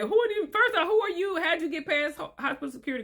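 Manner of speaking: high-pitched, incredulous questioning; acted startled challenge. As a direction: play a shocked parent challenging intruders, pitched high. Acted startled new parent challenging strangers.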